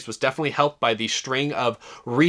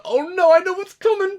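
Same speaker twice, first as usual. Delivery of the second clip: high, squeaky mock dread; high-pitched worried onlooker; dread-filled guy; 4 dB louder than usual. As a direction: imitate a guy who sees the wreck coming. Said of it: "I was this guy. I was the".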